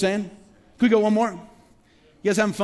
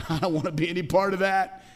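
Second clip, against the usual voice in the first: voicing the world. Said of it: "the world looks on and says".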